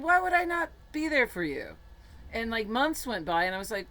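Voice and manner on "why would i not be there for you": retold exclaiming. Retold pleading question to friend.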